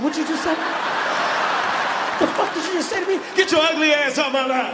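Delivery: loud, high outraged challenge; pissed off. Acted. Driver insulted by parking attendant, furious shouting. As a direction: fire back at an insult, outraged. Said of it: "This is what pissed me off".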